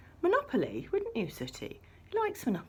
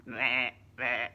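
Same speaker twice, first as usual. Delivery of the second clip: lamb bleat; 9 semitones lower than usual. Pretend. Voicing the lamb puppet's bleat.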